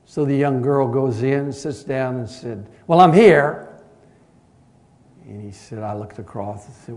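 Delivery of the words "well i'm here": loud, high demanding call; impatient demand. Retold or imitated. Impatient teenage girl demands to see the pastor, loud. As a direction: demand it across the office, fed up.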